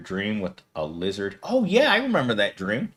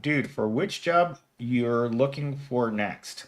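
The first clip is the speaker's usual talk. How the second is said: usual speaking voice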